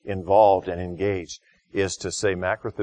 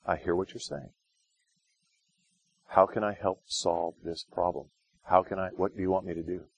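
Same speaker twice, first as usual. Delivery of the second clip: softer, gentle answer to a yeller; calm quiet reply; 6 dB quieter than usual. Shown as a demonstration. Answering someone who has been yelling, quiet and calm. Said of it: "just get real quiet".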